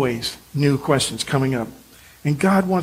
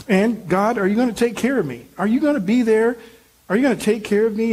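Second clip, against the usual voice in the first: higher, fast, anxious plea to God; begging for reassurance, pitched up; pleading Gideon; 7 semitones higher than usual. Acted. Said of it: "questions that Gideon had".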